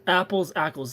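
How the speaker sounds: Ackles matched to the vowel of "Apples"; "Apples, Ackles" as an even rhyming pair; rhymes with apples. Shown as a demonstration. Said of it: "Rhymes with apples".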